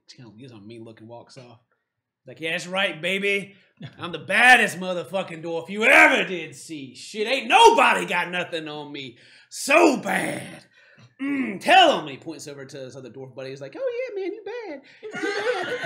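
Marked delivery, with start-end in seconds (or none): 2.4-12.1 louder, swaggering dwarf boast; drunken braggart dwarf. Acted. 13.8-15.8 very high squeaky cheerleading; hype-man dwarf buddy. Acted. Dwarf buddy hyping the boaster.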